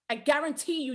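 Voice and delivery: usual speaking voice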